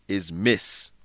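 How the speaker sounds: usual speaking voice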